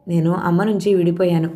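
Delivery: plain reading voice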